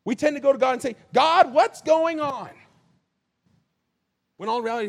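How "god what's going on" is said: slow loud cry of frustration; exasperated shout. Pretend. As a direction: wail it out at God, drawn out. Mock complaint to God.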